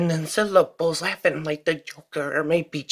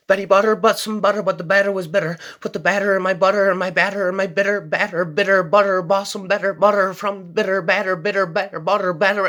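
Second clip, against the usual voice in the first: quick and loud; fast tongue twister; 2 semitones higher, 3 dB louder than usual. Shown as a demonstration. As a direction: rattle it off fast. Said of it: "Here comes some more tongue twisters".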